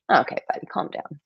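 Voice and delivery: hushed aside. Retold or imitated. Her reply to British critics of her accent, hushed. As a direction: drop to a quiet mutter, almost no voice. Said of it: "And I'm like".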